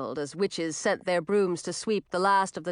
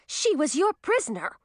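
higher, indignant outcry; cried out; 8 semitones higher than usual. Acted. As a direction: fling it at her, upset. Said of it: "cried Zoe".

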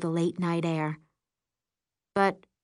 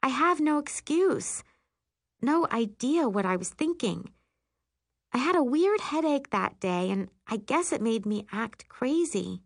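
higher, lively friend confessing a mishap; lightly voiced friend; 8 semitones higher than usual. Friend explaining her crazy act at camp, lightly voiced. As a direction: tell it as an animated, higher-pitched friend.

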